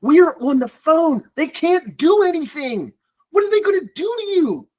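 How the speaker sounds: high, slow, drawn-out incredulity; exasperated disbelief. Real, not acted. Recruiter to colleague, slow high exasperated disbelief. Exasperated explaining about phone calls.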